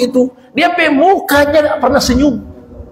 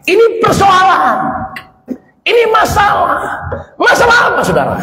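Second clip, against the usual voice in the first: preaching emphasis; 8 semitones higher than usual. Real, not acted. Preacher stressing the problem.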